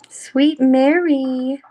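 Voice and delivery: slow, loud, stretched sweet greeting; drawn-out welcome. Real, not acted. Welcoming Mary onto the stream, slowly and sweetly. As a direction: savour her name as she arrives.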